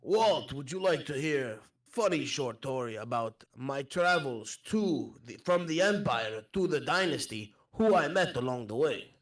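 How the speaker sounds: put-on eager storyteller with a foreign accent; accented traveller. Offering Walt a travel tale, accented traveller. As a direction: tell it like a wandering foreigner.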